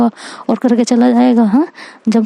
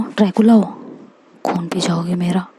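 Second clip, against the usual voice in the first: soft, lower teasing whisper; mocking whisper; 5 semitones lower, 5 dB quieter than usual. [name] teases Isha about being Dracula in a mocking whisper. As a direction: whisper the jibe like a spooky secret. [name] mocks Isha's blood threat.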